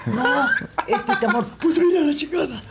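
very high, loud comic exasperation; exasperated exchange. Retold or imitated. Actress and co-actor argue over the heckler, exasperated. Acted exchange on stage, laughing.

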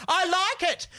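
high, loud, slow mock of someone talking over music in her ears; loud over her headphones. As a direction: imitate a friend shouting over her own headphones. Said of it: "she was speaking really loudly".

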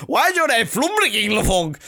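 high-pitched mock Norwegian questioning; baffled Norwegian. A Norwegian puzzling over his kid's name, mock baffled voice. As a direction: play a puzzled local, pitched well up. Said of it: "goes to Norway and he's like".

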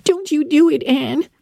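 put-on high, pleading girl's voice; pleading Diana. A narrator's reading. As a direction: beg her friend not to try it. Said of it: "entreated Diana".

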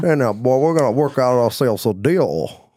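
a hint of a Savannah Georgia accent, lightly done; clumsy Georgia accent on a dealmaker's promise; bad Georgia accent. Closing a mock deal with his musician, in a bad Georgia accent. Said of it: "that's a terrible Georgia accent".

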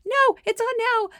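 very high, loud retort; retold frantic shout into the phone; urgent yell. Stand-in coach to absent coach mid-game, urgent yelling.

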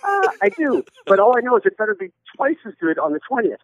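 high, anxious mock husband; mock nervous husband. Husband plays himself answering his wife, pitched high. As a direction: squeak it out like a cornered husband.

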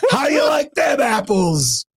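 high, slow gloating shout; taunting movie quote. Acted. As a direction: yell the famous line as a taunt. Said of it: "like the scene from Good Will Hunting".

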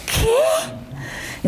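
high, slow mocking sneer; mocking retort. Imitating her cocky younger self answering the teacher. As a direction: sneer back like a cocky teen.

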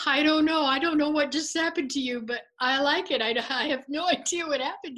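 high, loud, breathless wonder with a laugh; bubbling over with joy he can't explain; giddy disbelief. Retold or imitated. Tess telling Charlie he feels something new, giddy and high. Tess overwhelmed after the experience.

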